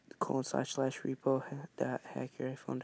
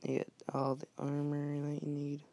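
normal talking voice

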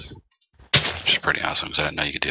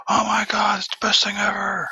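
mock excited; 4 dB louder than usual. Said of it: "he goes".

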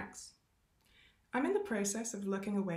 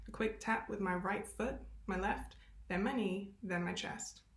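usual speaking voice

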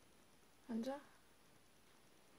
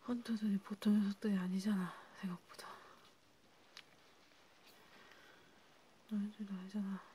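whisper. Acted. Warning fellow vampires about the hunters, whispering. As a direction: whisper it like a grim secret.

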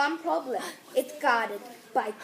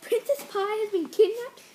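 shocked; 9 semitones higher, 9 dB louder than usual. Acted. Shocked knight hears news.

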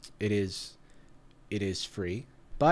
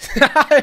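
high loud laugh breaking character; cracking up mid-bit; burst of laughter; 12 semitones higher, 10 dB louder than usual. Real, not acted.

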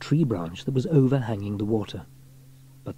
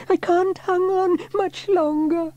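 slow, very high plea; stretched high-pitched cry of distress; boy's desperate cry; 4 dB louder, slower than usual. A narrator's reading. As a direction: plead for rescue in a small boy's voice. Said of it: "James cried".

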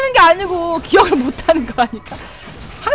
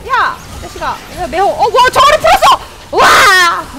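normal talking voice

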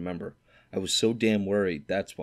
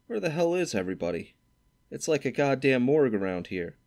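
higher, complaining Stradlater voice; griping roommate; 6 semitones higher than usual. A narrator's reading. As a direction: gripe about the empty hall. Stradlater griping about the cold.

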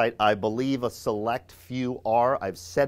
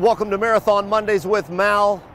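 announcer greeting; 9 semitones higher, 8 dB louder than usual. Real, not acted. Show opener, calling out greeting.

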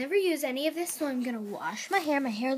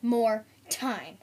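ordinary delivery

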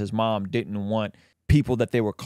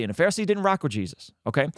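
usual speaking voice

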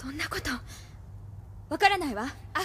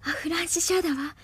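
ordinary talk

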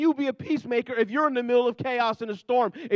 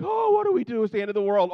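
mock panic; 3 semitones higher, 4 dB louder, faster than usual. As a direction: squeal like the sky is falling. Mocking panicked Christians.

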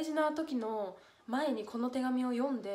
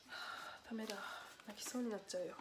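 softer, breathy voice, fighting back tears; near tears; 10 dB quieter, faster than usual. Real, not acted. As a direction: barely keep it together.

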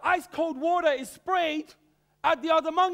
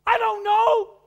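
a raised, stern refusal, higher and louder; stern church elder; 7 semitones higher, 9 dB louder, slower than usual. Acted. Church elder forbids Sabbath school after worship, stern and loud. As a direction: slap down the idea like an old deacon. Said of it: "Why not?".

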